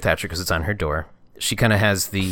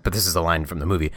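ordinary talk

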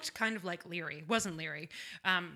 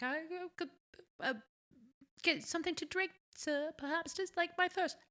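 higher, softer wheedling ask; sly prison lady; 8 semitones higher, 4 dB quieter than usual. Retold or imitated. Host imitates a prisoner begging a drink, higher. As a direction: coax a drink out of him sweetly. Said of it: "she was like".

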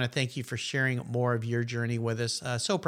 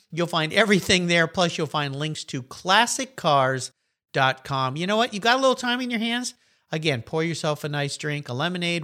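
normal talking voice

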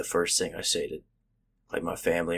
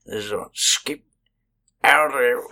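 loud, drawn-out country jabber; exaggerated country gibberish; 3 semitones higher, 5 dB louder, slower than usual. Shown as a demonstration. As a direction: garble it like a country construction hand. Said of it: "I'm speeding up to emphasize".

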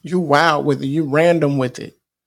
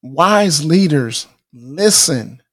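slow emphasis; much slower than usual. Real, not acted. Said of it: "slow emphatic key line".

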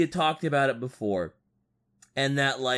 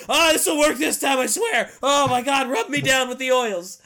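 high, loud mock begging; hammed-up desperate pleading; 8 semitones higher, 5 dB louder than usual. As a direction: shout the plea like a desperate zealot. Mocking the praying tech-priest.

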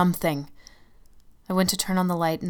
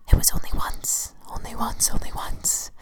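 obsessive whisper; 3 dB louder than usual. Acted. The shadow repeats obsessively.

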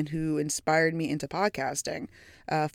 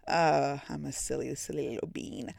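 cutesy self-mockery; 5 semitones lower, 5 dB quieter than usual. Real, not acted. Self-deprecating cute joke.

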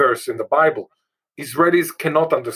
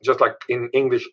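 usual speaking voice

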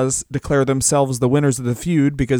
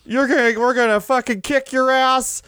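much higher silly boast; greedy cartoon wrestler; high goofy voice piling on Cokes; mock Outlaws voice; 9 semitones higher than usual. Voicing Outlaws at catering.

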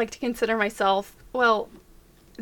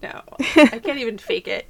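normal talking voice

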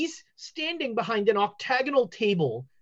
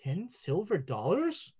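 offended seer; 4 dB louder than usual. Acted. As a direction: refuse the bribe indignantly. Fortune teller refuses bribe.

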